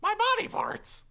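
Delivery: very high, loud scream; screamed body parts. Acted. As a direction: shriek in pain. Robot attacks the inventor.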